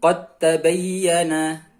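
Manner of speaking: "Qad tabayyana" slowly, as qat-tabayyana; dal merged into ta. Shown as a demonstration. Teacher shows dal disappearing, slow merged recitation. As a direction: drop the d of "Qad" into a doubled t. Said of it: "huruf dalnya hilang".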